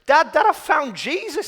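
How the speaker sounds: put-on a teenager running in, thrilled to tell his dad; dead excited. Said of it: "running in dead excited".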